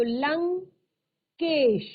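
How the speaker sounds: Lankesh drawn out slowly and clearly; slow teacher's reveal of Lankesh; slow drawn-out word. Shown as a demonstration. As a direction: stretch Lankesh out for the pupils. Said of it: "teacher reveals the joined word".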